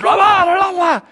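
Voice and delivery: high mock yell from a security guard; imitating the guard's yell. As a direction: shout the guard's cheerful gibberish at them. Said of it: "So he's yelling at us".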